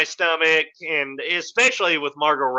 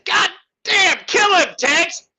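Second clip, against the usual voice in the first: high, loud hammed-up shriek; screaming hippie girl; 13 semitones higher, 4 dB louder, slower than usual. Imitating the hippie girl urging Tex, high shriek. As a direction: shriek the order like a crazed girl.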